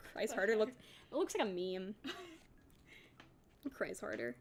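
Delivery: slow, giggling through the words; cracking up mid-read, barely holding it together; laughing. Real, not acted. Narrator cracking up at her own line, laughing through it.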